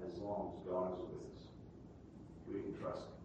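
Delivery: almost whisper the comfort; quiet reassurance. Real, not acted.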